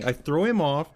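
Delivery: usual speaking voice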